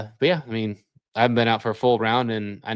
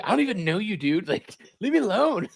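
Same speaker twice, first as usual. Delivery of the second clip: annoyed stranger; 10 semitones higher than usual. Retold or imitated. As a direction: whine it like a bothered stranger. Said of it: "It's like".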